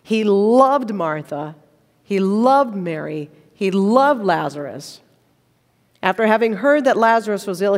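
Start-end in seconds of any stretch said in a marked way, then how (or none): 0.0-5.0 slow, weighty stress on "loved" each time; emphatic loved. Shown as a demonstration. Showing the congregation the Greek emphasis, slowly stressing "loved". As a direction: land hard on every "loved". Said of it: "it's emphatic".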